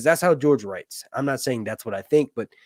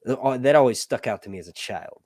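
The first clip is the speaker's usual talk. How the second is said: usual speaking voice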